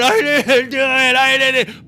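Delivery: high, loud mock sobbed denial; imitated crying. Boy denying it to his mom, mock crying. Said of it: "like, he's crying".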